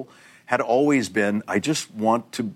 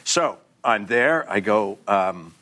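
usual speaking voice

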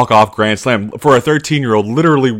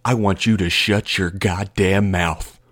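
menacing coach; 4 dB quieter, slower than usual. Retold or imitated. Coach in the player's face, slow quiet menace. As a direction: threaten him through the teeth. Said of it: "got in his face and goes".

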